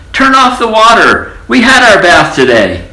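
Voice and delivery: high loud protest; crying out. A narrator's reading. Neighbours yelling at the mouse. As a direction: yell it in dismay. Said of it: "people next door cried".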